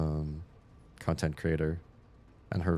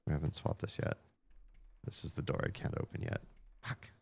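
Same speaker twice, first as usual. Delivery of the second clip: muttering to self; 10 dB quieter than usual. Real, not acted. Mutters to self after mistake.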